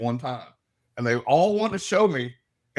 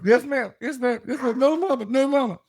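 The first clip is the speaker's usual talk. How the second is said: put-on much higher, louder, like cowering kids; scolded little boys; 10 semitones higher, 4 dB louder than usual. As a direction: squeak it out like boys caught by their mama. Spirit boys scolded by their mother.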